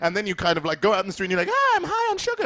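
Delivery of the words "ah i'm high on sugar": very high-pitched, giddy squeal; mock sugar high. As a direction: squeal it like a hammed-up sugar rush.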